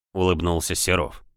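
usual speaking voice

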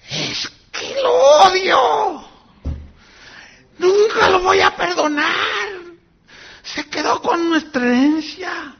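high, slow, loud sobbing cry; sobbing hatred. Retold or imitated. Woman in wheelchair crying to the pastor she'll never forgive. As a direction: wail it through tears of hatred.

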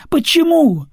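high, loud frightened cry; panicked question. Acted. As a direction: shriek the question in fear. Singer in panic asks.

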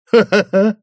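отрывистый хохот, как лай; захохотать отрывисто, будто лая; лающий хохот. A narrator's reading. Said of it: "захохотал, словно залаял". (хохот с лаем)

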